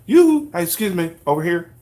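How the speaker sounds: mock announcer shouting for the crowd's attention; calling out. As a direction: hail the crowd loudly to get attention. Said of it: "somebody just comes on and says".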